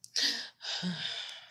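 long breathy exhale; weary role-play sigh; sad sigh. Pretend. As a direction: sigh out, pretending to be near tears.

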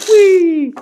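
high squeal of glee; playful whee. Pretend. Reviewer rolling a toy train, high playful squeal. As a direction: squeal like a kid on a slide.

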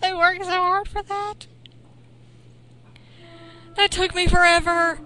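loud frustrated complaint. Real, not acted. Player fumes about the stolen teddy bear, loud frustration. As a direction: vent at the lost work.

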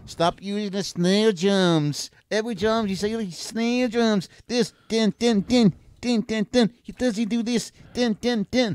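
high-pitched rant with imitated drum hits; mocking snare drums. Shown as a demonstration. Co-host mocks [name]'s overused snare, high-pitched mimicry. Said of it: "an impression of [name]".

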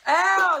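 pained yelp. Acted. Hit with a controller.

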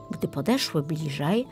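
plain reading voice

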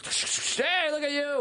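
high mock heckler voice; put-on high-pitched holler without the volume; pestering stranger. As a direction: imitate a pest calling out. Voicing strangers hassling someone famous.

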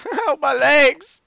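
giggling fake injury yell; laughing mock pain. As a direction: laugh while faking the pain. Pretend injury in game.

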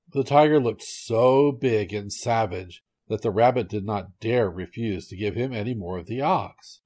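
plain reading voice

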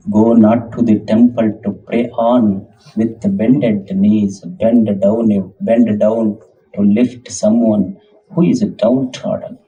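very slow, low recited quotation; solemn recitation. Acted. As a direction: lay out each phrase slowly like scripture. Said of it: "ಒಂದು ಮಾತು ಹೇಳ್ತಾರ". (ನಿಧಾನವಾದ ಗಂಭೀರ ಪಠಣ)

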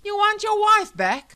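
very high, loud taunting challenge; angry wife. Acted. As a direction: hurl the question at him. Armed wife quarrels with husband.

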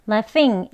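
laughing ending in a light "n", not "ng". Shown as a demonstration. Teacher shows the clipped ending. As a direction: cut laughing off at the n. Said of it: "그냥 이 아이엔에서 끝나는 laughing".

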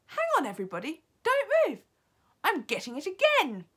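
high, loud, excited witch; urgent cry. A narrator's reading. Witch Mildred catching the scent, crying out urgently. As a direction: cry out to freeze the room. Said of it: "cried the witch called Mildred".

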